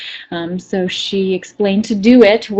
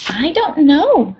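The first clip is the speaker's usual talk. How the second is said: Piglet; 7 semitones higher, slower than usual. Acted. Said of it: "answered Piglet".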